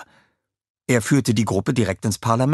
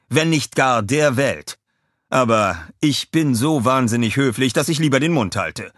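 higher-pitched, pointed broadcast delivery; reporter voice; 6 semitones higher, 4 dB louder than usual. A narrator's reading. Reporter too polite to predict doom, raised reporter voice. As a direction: report it with restrained disapproval. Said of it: "sagte er in die Kamera".

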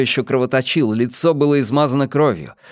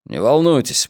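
ordinary delivery, nothing marked

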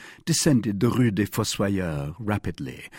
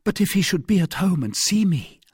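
high-pitched anxious woman; worried heroine; 10 semitones higher, 4 dB louder than usual. A narrator's reading. Wife fears being seen in a stranger's home, worried. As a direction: object in a lifted, worried voice.

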